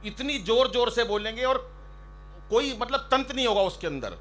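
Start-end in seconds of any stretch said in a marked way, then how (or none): none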